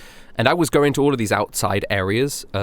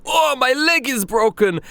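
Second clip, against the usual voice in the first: much higher, slow mock wail of a faker; fake injured cry; slower than usual. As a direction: overact the pain like a scammer.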